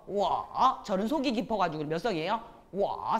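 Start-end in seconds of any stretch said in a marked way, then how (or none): 0.1-0.8 워 dipping low, then lifting; third tone. Shown as a demonstration. Teacher models third tone for students, 워 dipping low. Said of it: "삼성으로". 2.7-3.2 워 dipping low, then lifting; third tone. Shown as a demonstration. Teacher models third tone for students, 워 dipping low. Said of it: "삼성이네요".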